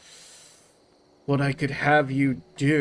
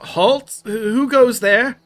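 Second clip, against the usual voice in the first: loud, high guard's challenge; alarm shout; 8 semitones higher, 8 dB louder, slower than usual. Acted. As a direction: bark it down from the wall. Guard calls out from wall.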